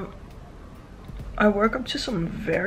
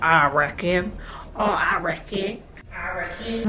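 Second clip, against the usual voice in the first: lightly mocking Australian accent; teasing imitation of an Australian accent; mimicked Australian accent. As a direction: parrot it back as Americans imitating Australian. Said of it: "Americans would be like".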